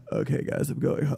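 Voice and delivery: whispering, worn out and sick; whispered. Retold or imitated. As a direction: breathe it out like you're done. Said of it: "I was just like".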